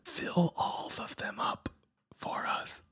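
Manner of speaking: slow, breath-only whisper; soft, voiceless stage whisper. Pretend. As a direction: coax a baby in a drawn-out whisper. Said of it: "really hard to talk like that".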